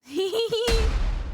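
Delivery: loud, high delighted laugh; burst of laughter. Real, not acted. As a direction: crack up out loud. Laughs at the young master.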